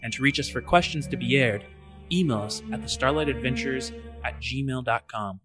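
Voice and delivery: ordinary delivery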